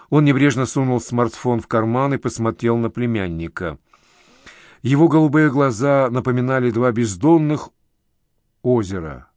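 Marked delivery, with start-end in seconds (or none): none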